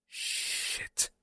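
unvoiced, barely audible curse; whispered curse. Sueno cursing silently to himself. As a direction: breathe the curse under your breath.

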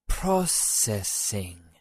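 Processing spelled out very slowly, syllable by syllable; sounded out. A narrator's reading. Yuki sounding out an English label on the machine. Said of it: "sounded out the label".

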